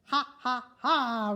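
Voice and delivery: loud, hammed-up giant laughter; belly-laugh like a greedy giant; roaring laugh. Giant gloating over his golden egg, roaring laugh. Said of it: "roared the giant".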